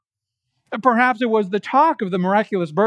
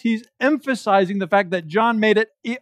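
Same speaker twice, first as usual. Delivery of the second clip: usual speaking voice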